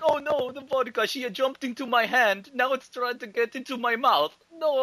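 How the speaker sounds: high, loud hammed-up despair; mock Boris. Boris fighting off the vodka, high, in hammed-up despair. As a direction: wail at the bottle in mock horror. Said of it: "He's like".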